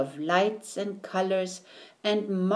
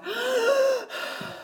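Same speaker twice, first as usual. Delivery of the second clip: high, strained gasp for breath; gasping for air. Acted. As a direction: gasp like a fish out of water. Fish stranded on the bank.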